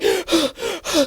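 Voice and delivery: heavy acted panting; imitated panting. Winded woman runner catching up, imitated heavy panting. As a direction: gasp for air like a winded runner. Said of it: "and she's panting".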